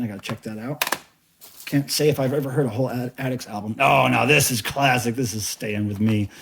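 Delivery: usual speaking voice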